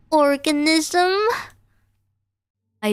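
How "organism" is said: high, slow synthetic boss call; a machine manager summoning its worker; robot boss voice. Acted. Robot boss startles the cashier, high machine call.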